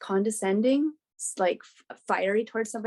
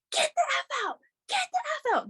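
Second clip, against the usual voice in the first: much higher, shrill-pitched panicked yell; retold scream; faster than usual. Yelling at a man in the bathroom, high panicked scream. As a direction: scream him out in a fright. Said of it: "this lady just screaming at him".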